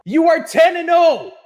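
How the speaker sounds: high, loud, incredulous emphasis on oh; exasperated shout. Acted. Ranting vlogger shouting at the Steelers, loud and incredulous. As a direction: yell it in disbelief at the team.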